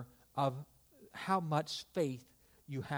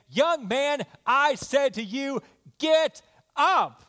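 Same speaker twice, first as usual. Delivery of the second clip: loud, high preacher's command; raised-voice order, building to the last words; commanding cry; 8 semitones higher, 7 dB louder than usual. Acted. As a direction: call the dead man up with ringing authority. Jesus commands dead man to rise.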